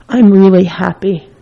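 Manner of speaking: ordinary talk, nothing marked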